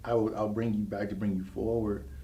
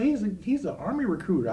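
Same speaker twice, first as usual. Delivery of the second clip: excited; 9 semitones higher, 3 dB louder than usual. Retold or imitated. Girlfriend explains, high voice.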